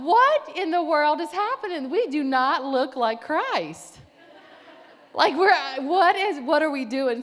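very loud, high, emphatic dismay; appalled outburst. Retold or imitated. Woman appalled at her friends' behavior, loud mock dismay. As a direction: cry out in retold exasperation. Retold appalled thought at the group.

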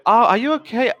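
high, sugary hostess voice; sweet 1950s housewife. Acted. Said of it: "And she says".

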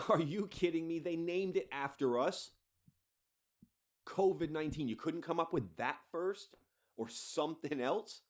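aghast, hammed-up indignation; incredulous mock outrage. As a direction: play a put-upon company in comic disbelief. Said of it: "poor bastards at Corona must be just like".

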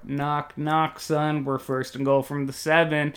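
ordinary delivery

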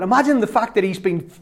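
ordinary talk

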